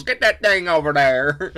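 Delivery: loud, drawn-out mock Western twang. Teasing Steve's failed New York accent, exaggerated Western twang. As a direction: mimic a friend's bad accent with a Western twang. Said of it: "put, like, a Western twang on it".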